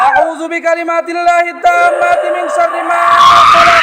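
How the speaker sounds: sustained chant on a level tone; Quranic healing recitation. Real, not acted. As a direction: intone the Arabic prayer with drawn-out vowels. Healer recites protective prayer. (ঝাড়ফুঁকের সুরে দোয়া পাঠ)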